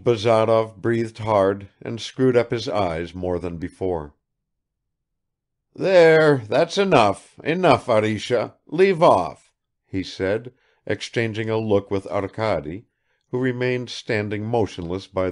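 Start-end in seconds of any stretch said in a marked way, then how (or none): 5.7-9.5 put-on higher and louder old father; husband hushing his weeping wife; gruff soothing. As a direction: hide emotion behind bluster. Father calming crying wife.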